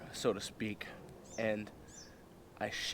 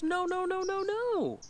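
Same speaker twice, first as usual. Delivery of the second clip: very high imitation of her refusing; high mock-female alarm; mock protest; slower than usual. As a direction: squeal a rapid string of refusals. Acts out her refusing again.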